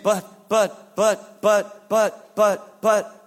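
loud, repeated, punched objections; argumentative. Acted. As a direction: keep butting in, each "but" hit hard. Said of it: "is the beginning of an argument".

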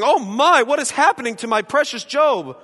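high, loud, mock fretting; mock fretting God. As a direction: ham up a panicked God. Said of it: "God is not up in heaven going".

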